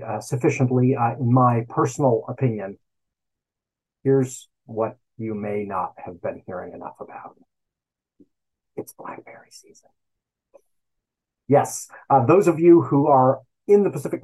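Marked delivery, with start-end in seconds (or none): 4.0-7.4 hushed build-up. Acted. Building up to the big news. 8.7-9.9 dramatic whisper. Acted. Revealing the big news.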